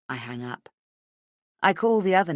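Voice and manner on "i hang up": ordinary delivery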